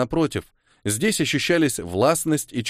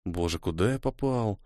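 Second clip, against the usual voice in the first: thinking aloud; 3 semitones lower, 8 dB quieter than usual. A narrator's reading. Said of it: "подумал Ниэль".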